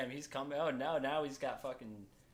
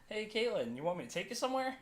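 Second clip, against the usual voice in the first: light, higher mocking voice of the driver; 7 semitones higher than usual. Friend mocks the driver's offer, lightly higher mock voice. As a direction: tease him by chirping his offer.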